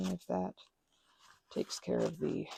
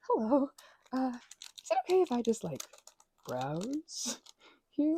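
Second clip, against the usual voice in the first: polite customer voice; 5 semitones higher, 8 dB louder than usual. Retold or imitated. Retold polite request to shop clerk.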